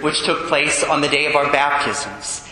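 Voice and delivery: usual speaking voice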